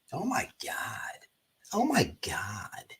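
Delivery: slow, breathless scandalized whisper; mock gasp whisper. Imitating a YouTuber pretending shock, whispered. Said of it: "weird angle".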